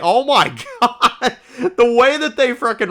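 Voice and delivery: high, slow "oh my God" through a laugh; reacting to a line of dialogue with a laugh; laughing disbelief. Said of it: "And I was like".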